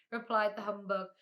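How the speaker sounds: ordinary delivery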